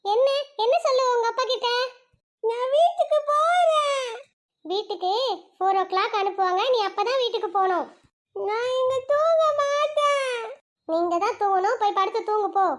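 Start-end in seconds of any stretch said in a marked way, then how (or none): 2.4-4.1 put-on high, slow child whine; whiny kid. Child whines to teacher about going home. 8.3-10.4 high, slow stubborn refusal; stubborn kid. Acted. Child refuses the teacher's order to sleep.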